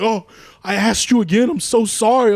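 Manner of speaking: loud, high imitation of apologizing; mock drunken apology. Guest imitating drunk partygoer who asked again, mock apology.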